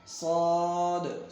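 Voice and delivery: صاد held long with rounded vowel; stretched صاد. Shown as a demonstration. Teacher models correct reading, صاد long and full. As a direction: chant صاد slowly, bouncing the final D. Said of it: "بلکہ پڑھنا ہے صاد".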